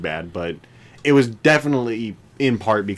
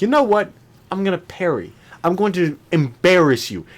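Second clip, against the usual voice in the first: cocky; 7 semitones higher than usual. Retold or imitated. Cocky acted quote.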